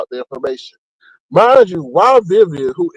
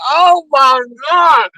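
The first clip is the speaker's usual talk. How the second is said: astonished shout; 8 semitones higher, much slower than usual. Retold or imitated. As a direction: drag it out, shouting in shock. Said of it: "I was like".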